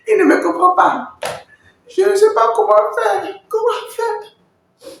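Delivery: high, loud, helpless complaint; exasperated learner. Acted. Learner not understood by a native Chinese speaker, exasperated. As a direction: cry out in high-pitched bafflement.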